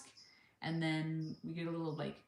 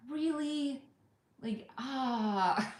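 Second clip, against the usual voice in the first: "Really?" leaping much higher in outraged disbelief; "Really?" squeaked high and louder, scandalized; incredulous; 8 semitones higher, 5 dB louder, much slower than usual. Retold or imitated. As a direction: shoot "Really?" up high, appalled. Exasperated at the seatbelt rule.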